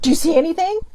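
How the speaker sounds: mother suddenly calling out from behind; sudden loud call. Retold or imitated. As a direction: startle her with the question. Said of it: "my mom's like".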